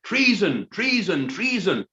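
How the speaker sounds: high, loud chanted accusation; soldiers shouting. Acted. Soldiers accuse Gordon, shouting treason. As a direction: bark it out three times. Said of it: "shouted the southern soldiers".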